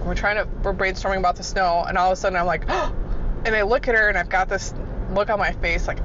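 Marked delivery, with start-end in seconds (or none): 2.7-3.4 sharp gasp. Retold or imitated. Sudden idea in meeting.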